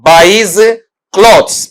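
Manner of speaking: slow dictation of the question; slow reading. Shown as a demonstration. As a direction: read the question out slowly with pauses. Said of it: "सवाल एक बार फिर से पढ़ देता हूं".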